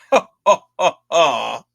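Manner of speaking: hammed-up villain ha ha; a lightly hammed horror host laugh; mock evil laugh. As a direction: fake cackle after every line. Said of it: "you gotta be like".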